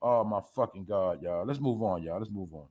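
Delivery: drops low and quiet, fading out; exasperated mutter. Real, not acted. Telling viewers to move on in a weary, quiet voice. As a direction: give up on the topic under your breath.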